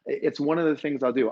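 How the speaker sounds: ordinary delivery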